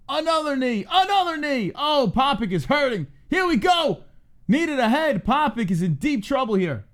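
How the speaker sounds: real excitement calling the fight; excited call. Real, not acted. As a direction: call each knee as it lands. Live fight call building.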